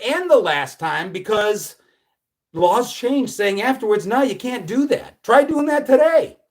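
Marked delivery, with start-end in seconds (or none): none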